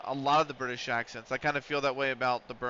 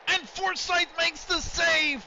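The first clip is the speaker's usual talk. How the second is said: much higher, drawn-out commentator shout; excited save call; 3 dB louder, slower than usual. Real, not acted. Streamer calling his keeper's save in the shootout, thrilled shout.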